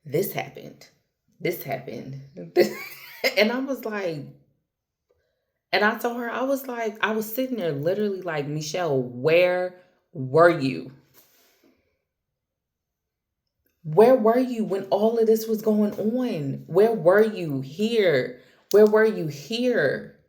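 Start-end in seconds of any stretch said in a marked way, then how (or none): 2.2-3.3 a burst of high-pitched laughter. Real, not acted. A friend's story sets off a high, bursting laugh. 8.5-20.2 louder, higher, drawn-out demand; exasperated demand. Retold or imitated. Asking her past self where she had been, loud and exasperated.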